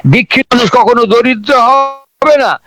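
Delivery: usual speaking voice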